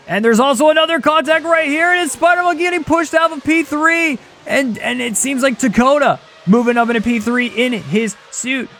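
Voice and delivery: urgent, high-pitched race call; excited play-by-play. Real, not acted. Commentator sees Spider Monkey pushed out, high excited play-by-play. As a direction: jump high with alarm at the contact.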